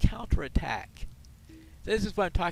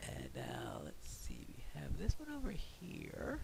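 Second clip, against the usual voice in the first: hushed whisper, much softer; 11 dB quieter than usual. Real, not acted. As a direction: whisper so nobody notices. Whispering while sneaking.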